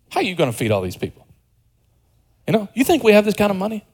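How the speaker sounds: fast, scoffing sarcasm; quick skeptical jab at Jesus; sarcastic skeptical. Retold or imitated. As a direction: rattle it off mockingly, doubting. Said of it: "it's very sarcastic".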